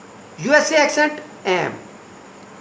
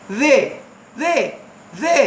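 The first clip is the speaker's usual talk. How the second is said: UK and US accent; 3 semitones higher, much slower than usual. Shown as a demonstration. Teacher shows the difficult version, UK/US-accent "they". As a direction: drill "they" word by word in a UK/US accent. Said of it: "ऐसे करके बोलते हैं वो".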